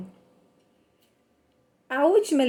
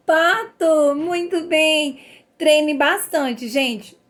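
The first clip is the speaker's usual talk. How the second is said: 1 cheerful praise; 5 semitones higher, 5 dB louder, much slower than usual. Acted. Teacher praising children.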